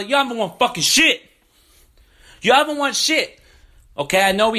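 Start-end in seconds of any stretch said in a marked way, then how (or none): 0.0-1.2 heated, raised and higher; rising into a real angry rant; angry outburst. Real, not acted. Angry rant at Clipper fans. 2.4-3.4 higher and harder, near a yell; real furious retort to rival fans; angrier repeat. Real, not acted.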